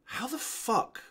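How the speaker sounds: high, softened incredulous thought; mock-stunned inner voice; retold inner disbelief. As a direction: think it aloud, high and stunned. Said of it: "I'm sat there thinking".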